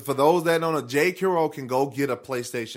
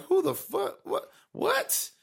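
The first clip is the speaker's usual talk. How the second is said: mock-shocked, voice leaping very high; startled disbelief; slower than usual. Retelling first meeting his co-host at the studio, high-pitched disbelief. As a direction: squeak it out in acted alarm.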